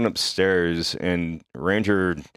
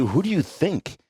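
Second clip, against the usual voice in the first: sarcastic retort; 9 semitones higher than usual. Retold or imitated. Sarcastic retort to angry coworker.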